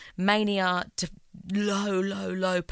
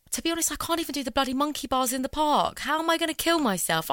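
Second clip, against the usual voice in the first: incredulous self-mockery; 8 semitones higher, 3 dB louder, faster than usual. Real, not acted. Absurd self-mocking aside.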